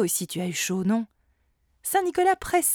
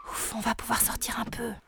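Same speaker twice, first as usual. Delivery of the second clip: whisper; 2 semitones higher, 7 dB quieter than usual. Acted. Kids sneak out quietly.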